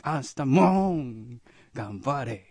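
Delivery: loud moans and slow, stretched words; Nyanchu impression. Performer cheering big sister on for tomorrow, Nyanchu impression. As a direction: drag out every vowel in a cartoon bellow. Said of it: "にゃんちゅうって難しいな".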